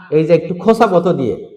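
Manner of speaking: usual speaking voice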